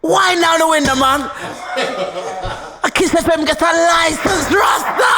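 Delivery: high-pitched, hollered Jamaican patois; yardie shout. Retold or imitated. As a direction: shout it like a yardie at a car window. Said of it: "he talked just like a yardie".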